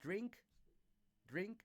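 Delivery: drink clipped short and fast; teacher modelling a quick short vowel. Shown as a demonstration. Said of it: "you have to pronounce it quick".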